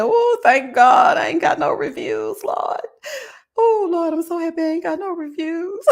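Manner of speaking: loud, high, slow "thank God"; mock-dramatic loud thanks to God; exaggerated relief. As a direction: cry out in hammed-up relief to the Lord. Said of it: "I said".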